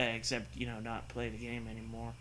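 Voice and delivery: ordinary delivery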